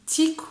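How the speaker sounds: Tiku said short and high; Malagasy word. Shown as a demonstration. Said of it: "la musique".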